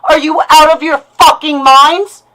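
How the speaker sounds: real rage, voice climbing on out; furious disbelief. Real, not acted. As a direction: demand it, incredulous and livid. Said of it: "what you just heard is a lash out".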